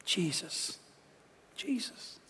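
very soft, fading to a breathy whisper; whispered dying plea; hushed plea. Retold or imitated. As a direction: call his name with the last of your breath. Dying thief pleads.

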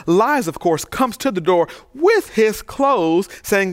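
ordinary delivery

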